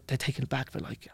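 ordinary delivery

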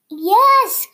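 loud, high glad cry; cried yes. A narrator's reading. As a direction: witch cries yes to the frog, loud and high. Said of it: "cried the witch".

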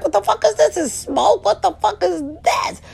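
high squeaky disbelief; shocked. Retold or imitated. At the restaurant, squealing high in shock at the smoke box. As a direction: gasp it out high in shocked confusion. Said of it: "I was like".

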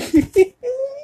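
squeaky laugh breaking out; a teary squeal of a laugh; high crying laugh. Real, not acted. Laughing while recalling childhood.

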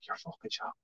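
imitated stunned whisper; hushed disbelief. Clerk hearing he's making the ring himself, whispered disbelief. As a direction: mouth it under the breath in disbelief.

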